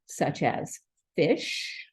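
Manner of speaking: "such as fish" drawn out slowly; stretched example word. Shown as a demonstration. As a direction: stretch "fish" to show the final sh. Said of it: "can also come at the end".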